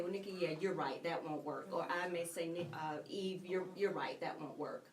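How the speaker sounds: ordinary talk, nothing marked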